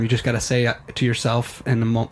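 ordinary talk

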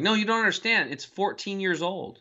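high imitation of amazed insisting, slowing at the end; excited insistence. Professor revealing the old hamburger's age, insistent. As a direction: act out someone driving home an astonishing fact.

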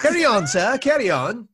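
posh English. Acted. Improv posh steward scene.

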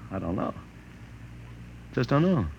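stunned quiet. Acted. Publicity man, shaken, murmuring to his assistant, hushed and dazed. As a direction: let it drain out of him, dazed.

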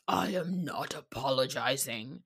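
deep, low mock shout, held in volume; bass-voiced shout. Recapping a housewife's outburst, imitating a bassy shout. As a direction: drop your voice low and mimic a bellow. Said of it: "She gets some bass in her voice, and she shouts".

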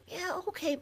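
a thin, tiny mock voice for the singer. Lead singer agreeing on the phone, in a high mock voice. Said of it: "And Donovan's like".

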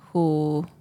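ordinary talk, nothing marked

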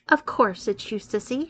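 plain reading voice

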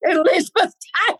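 high, fast, amazed repeat; squealing high in amazement; incredulous. Real, not acted. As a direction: gasp the name out in disbelief.

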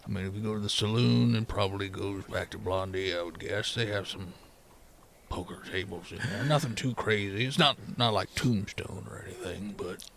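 low, soft miner's voice; hesitant, low-pitched townsman; tired miner. Acted. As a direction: speak low and quietly, noncommittal. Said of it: "and he just goes".

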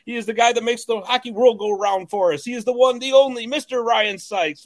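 announcer build-up, climbing high on the name; hype introduction. Acted. Host bringing in his co-host, grand hype introduction. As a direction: introduce a star like a ring announcer.

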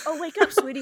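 high, sugary mock gentle mother; gently cooing mom, mocked with a laugh; mock sweet wake-up. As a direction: sing it sweetly as the mom who never was. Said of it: "not like".

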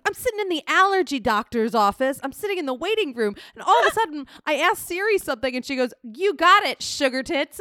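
high, loud, exasperated retelling; mom's embarrassed story. Retold or imitated. Mom telling how Siri embarrassed her, loud and high. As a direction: gush it out, mortified and laughing. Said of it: "and my mom's like".

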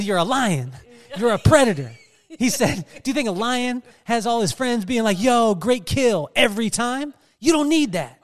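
pep talk. Retold or imitated. Brother hyping her up, loud pep talk. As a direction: fire her up like a coach.

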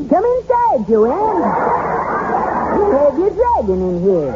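high little elf voice; christmas elf. Acted. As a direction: pitch way up like a tiny elf. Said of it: "Use an elf's voice".

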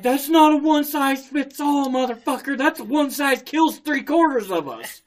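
loud, high comic outrage; retold indignant rant. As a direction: fire back in mock fury. Retold angry retort about life jacket.

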